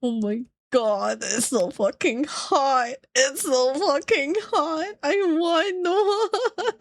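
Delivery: high, rising, whiny gush ending in laughter; high giddy longing, laughing; lusting fangirl. Retold or imitated. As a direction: swoon and beg, half laughing. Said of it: "I'm like".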